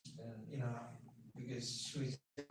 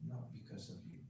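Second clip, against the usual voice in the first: soft consoling; 4 semitones lower, 7 dB quieter than usual. Retold or imitated. Doctor consoling grieving patient.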